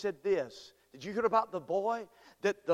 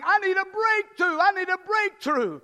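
high, loud desperate plea; David on the run pleading aloud; desperate cry; 11 semitones higher, 6 dB louder than usual. Pretend. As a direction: cry out for rescue like a hunted man. David voiced crying out in story.

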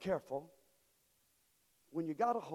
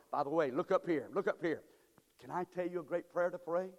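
normal talking voice

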